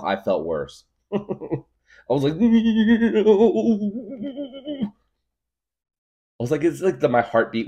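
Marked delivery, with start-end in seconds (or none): 2.4-4.9 loud high laugh. Real, not acted. Laughing hard at feeling worse. Laughing at his own reaction.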